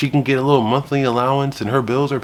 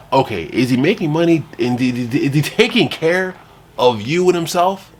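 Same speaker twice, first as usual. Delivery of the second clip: ordinary delivery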